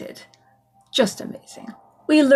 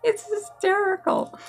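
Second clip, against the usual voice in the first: still laughing; 11 semitones higher than usual. Real, not acted. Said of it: "I can't stop laughing".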